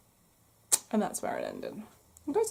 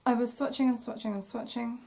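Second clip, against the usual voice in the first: frog in the throat; hoarse, catching throat; croaky voice. Real, not acted. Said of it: "I still haven't got that frog out of my throat".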